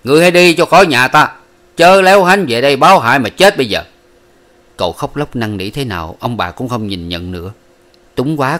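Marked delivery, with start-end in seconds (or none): 0.0-3.8 much louder, higher furious shout; scolding shout. A narrator's reading. Parents shout their son out of the house. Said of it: "chửi mắng rằng".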